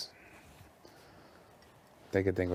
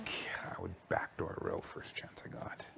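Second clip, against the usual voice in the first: secretive whisper; 6 dB quieter than usual. Real, not acted. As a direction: whisper it so nobody hears. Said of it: "Don't tell him I said that".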